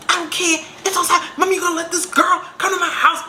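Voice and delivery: high, indignant back-talk; retold outraged complaint; acted defiant protest. Acted quarrel with mother.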